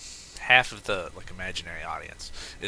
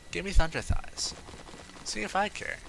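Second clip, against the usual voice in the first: mock defiant; 11 semitones higher than usual.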